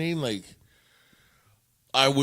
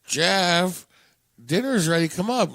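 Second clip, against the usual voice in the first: calling out; 4 semitones higher, 4 dB louder, slower than usual. Retold or imitated. Mother calls son a second time.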